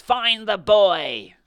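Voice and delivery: high, loud, slow furious shriek. A narrator's reading. Blind man shrieking to the pirates. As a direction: scream out of the window. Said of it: "he shrieked".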